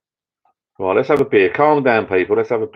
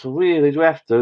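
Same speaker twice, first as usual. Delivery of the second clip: put-on higher whiny child; reluctant kid; 8 semitones higher than usual. Child reluctant to visit aunts.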